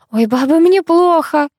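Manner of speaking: high, slow lament; theatrical moan of being overcome; sighing swoon. A narrator's reading. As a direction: draw it out as a dramatic complaint. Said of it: "вздохнула Синицына".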